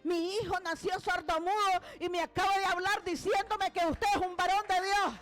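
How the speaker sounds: retold tearful woman. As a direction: retell her tearful testimony with rising fervour. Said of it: "la mujer entre sollozos le dice".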